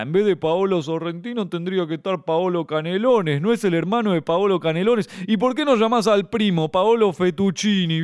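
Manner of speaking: lightly voiced smug joke teller; a hint of a pleased punster building up; mocking a silly joker. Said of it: "el loco que me comenta".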